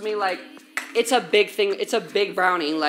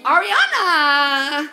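calling out; 5 semitones higher, 4 dB louder, much slower than usual. Real, not acted. Calling out again.

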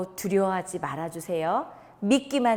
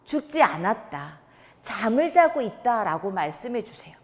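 usual speaking voice

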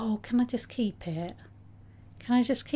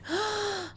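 gasp; 11 semitones higher, 3 dB quieter than usual. Real, not acted. Shock at game warning.

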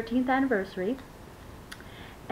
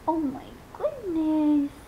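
awed gushing; 6 semitones higher than usual. Real, not acted. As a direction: react in delighted awe. First sight of doll's feet.

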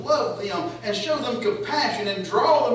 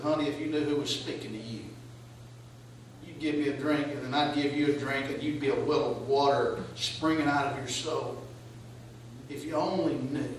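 low, soft and gentle, coaxing her; low, soft tender voice of Jesus to the woman; 5 semitones lower, 7 dB quieter than usual. Acted. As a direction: speak to her like a patient friend.

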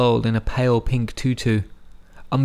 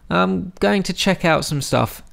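calling out; 7 semitones higher than usual. A narrator's reading. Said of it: "he called to Zalmay".

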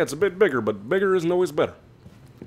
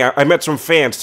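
ordinary talk

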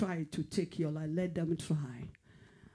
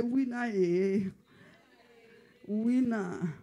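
proclaiming; 5 semitones higher, much slower than usual. Acted. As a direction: call out winner slowly. Preacher leads congregation chant.

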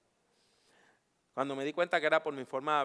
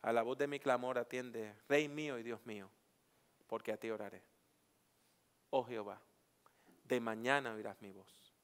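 psalm reading; 3 semitones lower, 4 dB quieter than usual. Acted. Preacher reading a psalm to the class, solemn. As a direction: read the psalm as a prayer.

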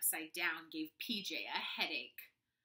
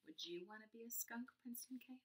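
quiet aside; 10 dB quieter, faster than usual. Real, not acted. Aside asking the child listener.

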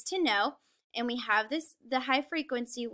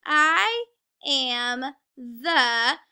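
very slow, stretched sight words, pushed louder; reading word by word; 6 dB louder, much slower than usual. Shown as a demonstration. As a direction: stretch out each word for beginning readers. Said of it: "So let's look at them".

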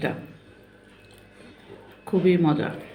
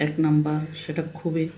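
usual speaking voice

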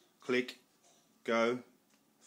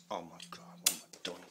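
embarrassed mumble; 4 semitones lower, 7 dB quieter, faster than usual. Real, not acted. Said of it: "I'm excited. I'm sorry".

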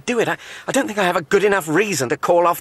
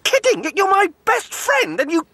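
high, bursting with relief and delight; gleeful outrage. Acted. Mark to Jeremy on hearing the snog, gleeful outrage. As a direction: shout it like the best news ever. Mark thrilled at friend's betrayal.

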